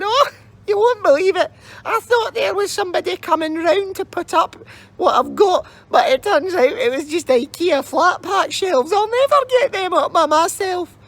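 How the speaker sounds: put-on very high, whimpering old crone; wailing old lady.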